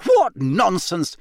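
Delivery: put-on high, exasperated older woman; indignant. A narrator's reading. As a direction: brush the idea off crossly. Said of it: "She shot a very angry look".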